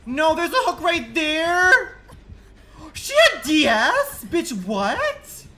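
high, loud disbelieving shriek; a player yelling at a ruined rescue; shocked yell. Real, not acted. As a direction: scream your disbelief. Shocked at hook outcome.

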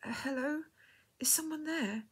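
high, tentative, quivering call. A narrator's reading. Tearful woman answering an unseen voice, quivering. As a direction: call out unsurely, close to tears. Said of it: "asked in a quivering voice".

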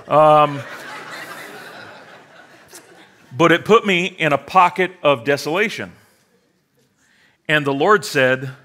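ordinary delivery

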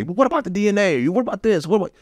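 high, urgent imitated protest; pleading to be heard in court; mock pleading objection. Defendant silenced by the court, mock protest. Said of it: "you can't say".

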